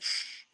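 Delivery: long soft shush; self-hushing shush. Acted. Talking to himself in the mirror, hushing. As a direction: hush yourself with a drawn-out sound.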